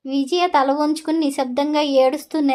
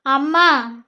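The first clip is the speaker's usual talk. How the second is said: a raised shout of Amma across the hall; loud call for mother; slower than usual. Acted. Husband calling his mother into the hall, loud shout. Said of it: "గట్టిగా అరిచాడు".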